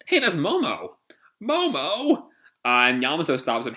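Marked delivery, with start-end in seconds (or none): 0.1-2.3 high-pitched acted recognition; excited calling out. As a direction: call out eagerly to a friend spotted. Luffy spots Momo, calls out.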